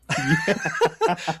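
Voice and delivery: sudden high squealing laugh; high burst of laughter. Real, not acted. Telling the silver medal story, high laughing burst. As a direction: break into laughing at yourself. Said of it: "my first instinct was like".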